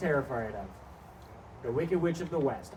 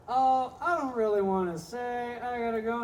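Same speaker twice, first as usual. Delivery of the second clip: higher, slow polite excuse; mock polite woman; 8 semitones higher than usual. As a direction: nervously brush him off. Coworker politely declining.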